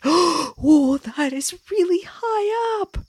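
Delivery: high, breathless gasp of wonder; amazed gasp. Acted. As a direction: gasp and marvel at the height. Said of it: "shocked by the height".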